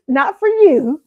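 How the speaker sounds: high, drawn-out teasing refusal; God's refusal. Retold or imitated. God to her after days of bad taste, teasing refusal. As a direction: sing out a slow no, laughing midway. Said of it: "It's like God was saying".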